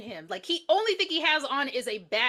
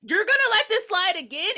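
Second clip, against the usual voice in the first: exasperated; 8 semitones higher, 5 dB louder than usual. Retold or imitated. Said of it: "And then we're like".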